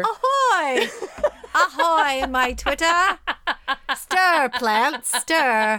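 loud, high hammed-up cries; mock proclamation. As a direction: shout it like a pirate. Mock chanting the caption.

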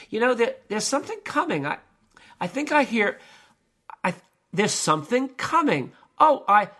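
high, acted alarm building to panic; mounting alarm. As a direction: play a climber growing frightened. Doomed climber's voice before the scream.

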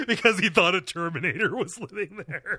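high, laughing retelling of something absurd; cracking up while explaining. Real, not acted. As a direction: tell it through rising giggles. Laughing over absurd tank story.

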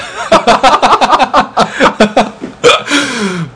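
long laugh rising high then falling. Real, not acted. Host laughs at website joke.

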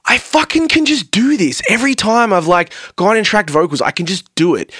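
high-pitched indignant insistence, easing down; retold pissed-off protest. Singer recalling frustration at failed vocal takes, pissed-off insistence. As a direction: replay your frustrated protest, starting high. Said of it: "really pissed off because I'm like".

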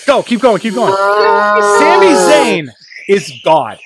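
loud, fired-up voicemail rant; yelling rant. Acted. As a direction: yell it like an angry radio caller. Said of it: "I need to get my yell on".

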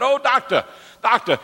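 high, tongue-tied imitation of an old preacher; excited high character voice with muddled consonants; Uncle Buddy Robinson. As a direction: exclaim to the doctor in a high put-on voice. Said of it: "Uncle Buddy was tongue-tied".

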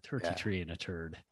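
soft mock-Irish accent, tossed off low; leprechaun-y Irish accent. Shown as a demonstration. Repeating the Irish phrase to himself, quiet mock-Irish accent. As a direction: murmur it in a mocking Irish accent. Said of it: "more like a leprechaun-y sort of".

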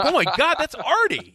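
high, excited fan squeal; starstruck gasp. Retold or imitated. Fan spotting Artie in class, excited squeal. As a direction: gush like meeting a celebrity. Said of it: "I'm like".

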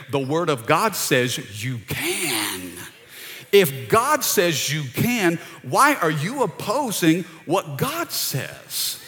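slow, weighted words on GOD; emphatic preaching. Real, not acted. A preacher pressing the point slowly to the church. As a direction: lean hard on each phrase. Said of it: "preaching emphatically".

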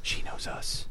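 hushed voices; whispering. Real, not acted. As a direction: keep it hushed, under the breath. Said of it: "Why are we whispering?".

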